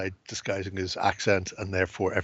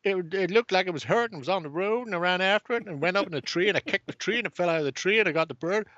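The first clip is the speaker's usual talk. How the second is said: higher, lightly voiced eight-year-old boy; excited little boy; 9 semitones higher, faster than usual. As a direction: pitch it up like a proud little kid. Kid explains catching the vulture.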